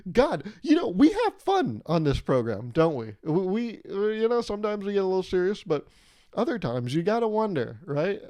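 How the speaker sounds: jumps way up in pitch and volume, half-joking hype; hyped-up aside. Real, not acted. Vlogger hyping up the audience, loud and high-pitched. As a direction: suddenly pump up the energy like a cheesy host.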